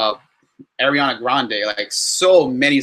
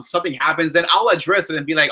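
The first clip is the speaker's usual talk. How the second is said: ordinary delivery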